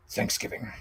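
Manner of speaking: a breathy whispered title; dramatic whisper. Acted. Vlogger whispering the movie's name as he unveils the toy. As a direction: hush it like a horror title card.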